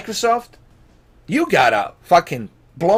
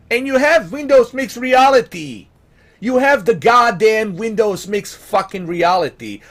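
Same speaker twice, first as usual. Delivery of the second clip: louder, higher, fed-up outburst at the company; angry rant; 5 semitones higher, 6 dB louder than usual. Real, not acted. Gamer ranting at Microsoft over missing VR support, angry. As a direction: vent real frustration, hammering each word. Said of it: "Why am I getting angry?".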